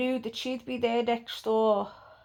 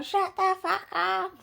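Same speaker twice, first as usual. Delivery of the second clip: imitated yell; 8 semitones higher, slower than usual. Pedro yelling at the banging neighbour, imitated high mock shout. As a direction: mimic someone yelling, high and stretched out.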